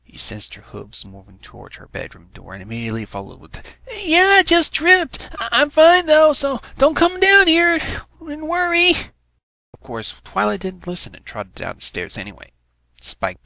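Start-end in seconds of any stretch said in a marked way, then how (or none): 3.9-8.9 high, loud, hurried reassurance; nervous cover-up. A narrator's reading. Spike calling up to Twilight, nervous reassurance. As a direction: fake calm to keep her away.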